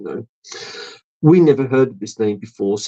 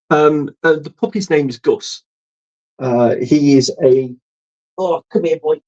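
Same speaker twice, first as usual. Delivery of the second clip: ordinary delivery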